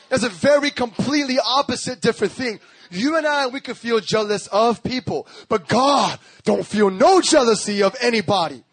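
high, emphatic pulpit delivery; forceful preaching. Real, not acted. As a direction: preach it, stretching and punching key words.

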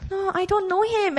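lightly acted, high-pitched brush-off; casual denial. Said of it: "this was not just".

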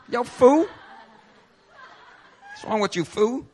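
light Philly street voice, fool as foo, laughing; Philly street talk. Pretend. Preacher joking in a light Philly accent, laughing. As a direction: tease like a Philly guy on the corner, lightly. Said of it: "Now in Philly".

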